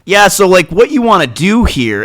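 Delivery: loud, high, hammed-up doctor asking what's up; mock doctor. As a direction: belt out a mocking impression of a clueless doctor. Mocking doctors' dismissive questions.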